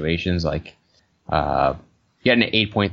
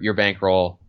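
usual speaking voice

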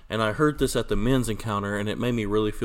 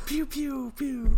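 high playful pew pew zaps; mock gun noises; much slower than usual. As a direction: toy laser blasts, very high. Mock gun sound effect.

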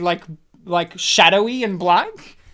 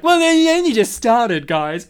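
raised, loud mocking voice; mock typical wanker; 3 semitones higher, 6 dB louder than usual. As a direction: imitate a whiny nitpicking listener. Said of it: "like a typical wanker".

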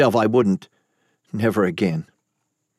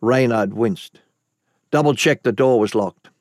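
usual speaking voice

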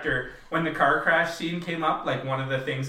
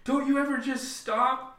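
put-on losing it on a kid; 13 semitones higher than usual. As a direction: retell a frazzled parent's outburst in a high, strained voice. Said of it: "she loses it on him".

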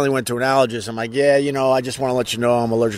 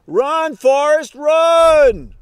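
a real full shout, much higher and louder; yelling Run Forrest; 7 dB louder, much slower than usual. Real, not acted. Yelling at a runner going by, full shout. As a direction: bellow it at a passing runner. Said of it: "I yell".

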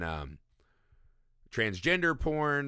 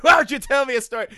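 fast, high, loud rant; mock indignant yell; 13 semitones higher, 10 dB louder, faster than usual. Podcaster playing the father confronting the doctor, mock indignant yell. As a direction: fire it off in hammed-up outrage.

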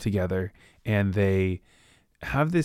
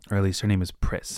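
ordinary talk

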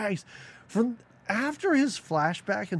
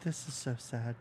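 put-on low, soft, flat lament for a ghost kid; lightly voiced ghost child; flat ghost commentary; 3 semitones lower, 3 dB quieter than usual. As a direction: murmur it as empty sympathy. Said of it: "they're doing little commentary".

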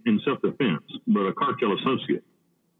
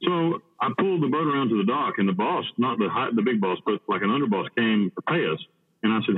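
usual speaking voice

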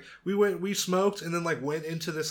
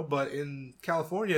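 normal talking voice

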